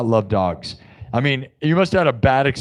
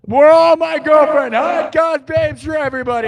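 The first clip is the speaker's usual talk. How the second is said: high, loud whoop to the whole crowd; excited shouting; 13 semitones higher, 5 dB louder than usual. Acted. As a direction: shout it out giddily, high, then easing down.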